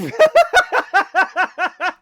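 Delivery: big high real laugh; laughing hard at a horrifying line; loud burst of laughter. Real, not acted. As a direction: crack up out loud.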